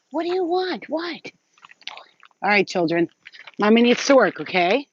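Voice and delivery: high sing-song pet voice; pet talk. Real, not acted. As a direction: coo up high at the animal. Talking to her pet.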